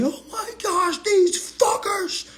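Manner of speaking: very high, slow, whiny mock despair; hammed-up high whine of frustration; mock upset litigant. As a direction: play the sulking loser. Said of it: "I'm not gonna get sad and say".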